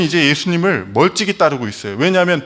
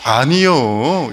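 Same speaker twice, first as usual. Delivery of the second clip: very slow, drawn-out Jeolla "아니요"; Jeolla dialect; much slower than usual. Shown as a demonstration. Pastor showing his home region's no, in slow Jeolla dialect. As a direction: stretch "아니요" out long like Jeolla folk. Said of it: "전라도에서는".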